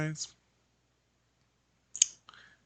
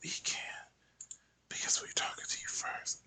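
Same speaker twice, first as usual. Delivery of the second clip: hushed explanation; whisper; 10 dB quieter than usual. Retold or imitated. Imagined client explaining, whispered. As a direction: keep it to a whisper.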